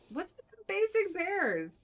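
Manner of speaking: high, loud, fed-up complaint; a loud, high whine of frustration; exasperated outcry. Real, not acted. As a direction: throw your hands up at the bad luck. Frustrated at bad bear rolls.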